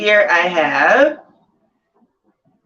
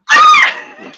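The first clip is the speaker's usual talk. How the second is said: high delighted squeal of a laugh; giddy shriek of laughter; 8 dB louder than usual. Real, not acted. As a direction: squeal with joy at the news. Excited over reaching fifteen hundred.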